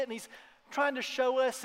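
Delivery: ordinary talk, nothing marked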